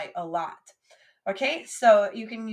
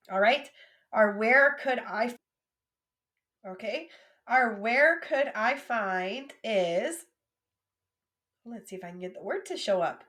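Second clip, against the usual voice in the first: ordinary talk, nothing marked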